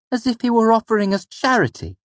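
put-on high young boy's voice, full of scorn; scathing. A narrator's reading. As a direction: spit it out with boyish contempt. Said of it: "I said scathingly".

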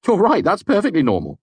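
a high-pitched young man's voice, lightly mocking; a friend teasing Kvothe about testing the doll, voiced high; Simon voiced. A narrator's reading. Simon's voice, laughing remark.

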